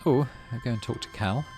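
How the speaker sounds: ordinary talk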